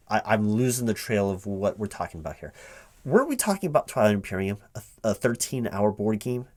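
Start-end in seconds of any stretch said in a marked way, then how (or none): none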